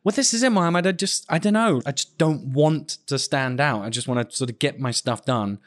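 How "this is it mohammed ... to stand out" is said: usual speaking voice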